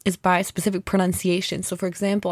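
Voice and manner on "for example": ordinary talk, nothing marked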